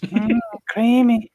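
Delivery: high, slow, drawn-out character voice; character catchphrase. Acted. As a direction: stretch it out high and creamy. Quoting Old Greg in character voice.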